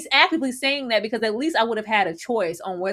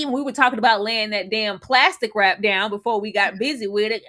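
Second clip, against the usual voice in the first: ordinary talk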